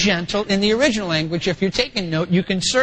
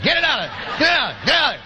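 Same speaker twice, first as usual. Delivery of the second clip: raised, brusque repeated command; mock dad urgency; 3 semitones higher, faster than usual. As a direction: play a dad briskly rubbing away the hurt. Acting a dad rubbing a sore.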